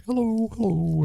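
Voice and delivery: put-on high, sing-song child's hello; piping up like a tiny girl at the door; little girl greeting. Small daughter greets the delivery rider, high and sing-song.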